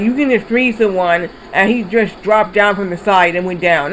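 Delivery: usual speaking voice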